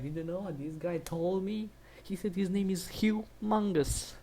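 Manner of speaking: high, put-on scandalised voice; mock outraged reaction. Imitating a feminist telling her group, mock outrage. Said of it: "that was basically her reaction".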